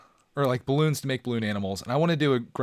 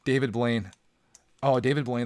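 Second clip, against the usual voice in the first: normal talking voice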